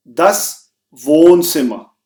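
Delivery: very slow, clear German word for learners; slow teacher's dictation pace; slow for repeating. Shown as a demonstration. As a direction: spell it out slowly so they can repeat. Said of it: "मैं कोशिश करूंगा कि मैं बिल्कुल slow बोलूं".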